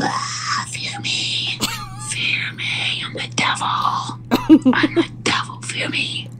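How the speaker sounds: breathy seductive whisper. Acted. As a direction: whisper it like a temptress. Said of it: "In your most seductive voice".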